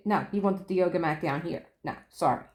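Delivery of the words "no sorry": ordinary delivery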